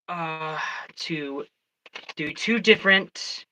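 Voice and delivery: ordinary talk, nothing marked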